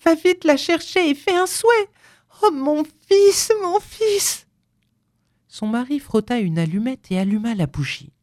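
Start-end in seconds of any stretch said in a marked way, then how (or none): 0.0-4.5 very high, desperate maternal cry; panting plea. A narrator's reading. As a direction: plead frantically, wailing for your son. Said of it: "reprit-elle d'une voix haletante". (supplication haletante)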